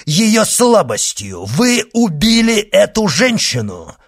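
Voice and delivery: loud, high impassioned cry; outraged accusation. Acted. Monk accuses the executioner of killing a woman, outraged. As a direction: hurl the accusation at full voice.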